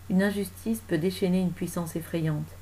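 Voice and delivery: usual speaking voice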